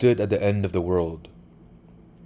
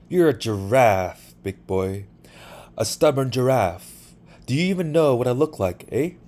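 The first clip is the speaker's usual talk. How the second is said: put-on higher-pitched, louder girl's voice; lilting, raised-pitch taunt; teasing girl; 5 semitones higher, 5 dB louder than usual. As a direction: play a brash girl needling a stubborn boy.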